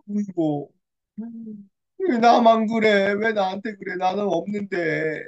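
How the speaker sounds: slow, drawn-out mock lament; hurt wailing. Hannah wounded by the rival's taunts, hurt wailing. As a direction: act out a wounded, self-pitying moan. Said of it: "너무 상처를 받았어요".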